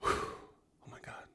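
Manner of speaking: hushed, drawn-out whisper; overwhelmed whisper. Real, not acted. Host overwhelmed by the Ripple news, awed whisper. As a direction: breathe it out slowly, barely there.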